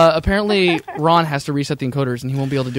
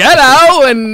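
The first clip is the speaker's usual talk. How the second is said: high, loud, put-on British "Hello" tossed back; mock British reply; 9 semitones higher, 7 dB louder, much slower than usual. Friend copying the British dad's accent, loud, high mock British. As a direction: mimic his accent right back at him. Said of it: "and I'm like".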